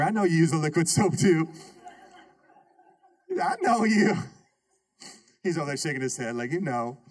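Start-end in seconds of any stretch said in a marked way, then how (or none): 3.4-4.2 teasing shout. Real, not acted. Teasing Junior across the room.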